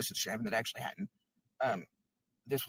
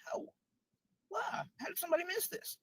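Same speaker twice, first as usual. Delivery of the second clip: much higher, pleading incredulous questions; mock bewildered. Imagining bystanders after a death, high bewildered wail. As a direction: squeak out a disbelieving how. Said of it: "never be like".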